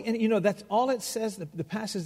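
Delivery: ordinary delivery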